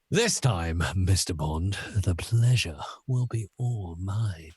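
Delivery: low, slow, whispery villain; sinister hushed baddie; Bond villain. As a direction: savor it darkly, fading into a whisper. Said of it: "as if he was a Bond villain".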